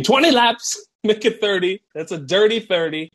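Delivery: high, punchy coach order; barked order. Retold or imitated. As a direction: snap the laps at the team. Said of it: "He tells the team".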